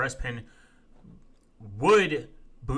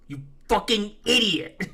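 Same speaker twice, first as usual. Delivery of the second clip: a higher, fed-up jab at the idiot; exasperated insult; 8 semitones higher than usual. Real, not acted. Streamer insulting a commenter, exasperated and higher. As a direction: spit out the insult in frustration.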